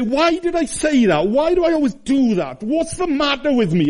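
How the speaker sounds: high, pleading cry of torment; anguished self-questioning. Retold or imitated. Preacher voicing a tormented sinner, high anguish. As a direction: voice the sinner's despair, high and strained. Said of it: "You say".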